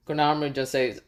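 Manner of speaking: plain reading voice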